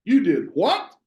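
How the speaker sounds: you did what, pitch jumping up on what; disbelieving question rising sharply on what; rising question tune. Shown as a demonstration. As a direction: sound incredulous, swing up on what. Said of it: "It tends to go up".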